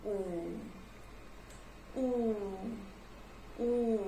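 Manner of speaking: ऊ drawn out with rounded lips; long oo sound. Shown as a demonstration. Teacher showing the class how to say ऊ, rounded lips. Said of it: "इसे हम pronounce कैसे करेंगे?".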